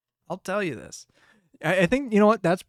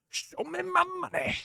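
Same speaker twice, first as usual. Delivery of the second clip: much higher, softer, laughing squeak; squeaky mock demand; 6 dB quieter than usual. To Taco Bell over the drink's name, a high laughing squeak. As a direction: squeal it like a cartoon creditor, giggling.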